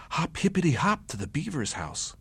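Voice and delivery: ordinary delivery, nothing marked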